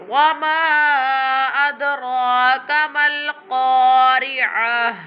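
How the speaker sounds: slow chant, "ma" held very long before "adraka"; stretched madd. Shown as a demonstration. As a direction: draw out the long vowels in "Wa ma adraka mal qari'ah". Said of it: "Okay, so stretch it".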